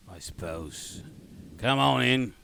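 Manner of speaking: reluctant Cockney gate guard; shitty Cockney accent. Acted. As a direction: give in grudgingly, in bad Cockney. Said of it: "my shitty Cockney accent".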